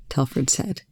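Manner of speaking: ordinary delivery, nothing marked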